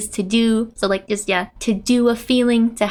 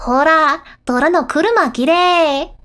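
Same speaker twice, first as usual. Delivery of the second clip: excited calling out; 5 semitones higher, 4 dB louder than usual. Acted. Acts friend admiring burning car.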